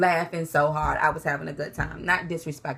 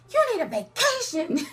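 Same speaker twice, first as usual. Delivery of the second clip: playful tease; 13 semitones higher than usual. Retold or imitated. Teasing attorney in court.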